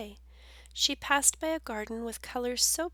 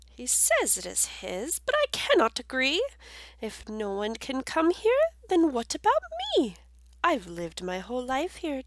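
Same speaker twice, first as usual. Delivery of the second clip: high, louder rabbit voice; little rabbit; 3 semitones higher, 4 dB louder than usual. Acted. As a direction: plead your case as a small rabbit. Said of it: "who started to speak".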